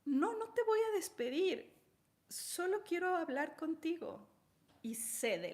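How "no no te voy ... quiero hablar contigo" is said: much higher, pleading to calm someone; high-voiced flustered doctor; startled doctor. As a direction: beg the screaming assistant to calm down. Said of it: "la doctora le dice".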